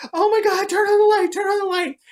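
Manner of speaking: loud, very high panicked yelling; frantic mock-terrified high shout; freaking out. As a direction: mimic friends shrieking in panic. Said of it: "like, freaking out".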